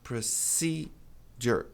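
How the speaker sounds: Procedure very slowly, stressed ce, ending in er; correct pronunciation, slow. Shown as a demonstration. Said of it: "A pronúncia correta é". (pronúncia correta bem devagar)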